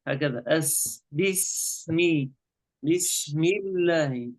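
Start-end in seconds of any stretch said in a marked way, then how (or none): 1.1-4.3 "بسم الله" slowly with a long s; sustained sin. Shown as a demonstration. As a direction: hold the س in "بسم الله" for its full time. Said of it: "لابد نعطي الحرف وقته".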